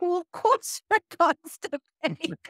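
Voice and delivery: slow, high, laughing through the words; laughing retort. Retold or imitated. To the audience about constipated clients, laughing slowly. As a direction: chuckle as you state the obvious.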